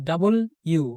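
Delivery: "ডাবল ইউ" slowly split into double and U; two-word pronunciation. Shown as a demonstration. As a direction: spell out "ডাবল ইউ" as two slow, separate words. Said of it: "দেখো, একটা ইউ". ("ডাবল ইউ" ধীরে দুই শব্দে ভেঙে উচ্চারণ)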